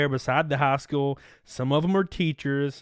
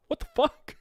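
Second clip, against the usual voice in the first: high, laughing what the fuck; squeaky laugh at the absurd memory; laughing disbelief; 10 semitones higher than usual. Real, not acted. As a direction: crack up in disbelief. Laughing at his own story.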